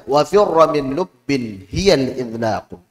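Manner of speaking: Wa firra min lubbin said slowly, each word clear; slow recitation. Shown as a demonstration. Teacher reciting the idlaq verse for students. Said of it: "Kita baca".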